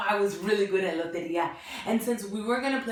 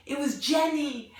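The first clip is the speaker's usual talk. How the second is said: ordinary delivery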